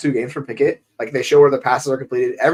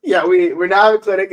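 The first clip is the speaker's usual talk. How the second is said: usual speaking voice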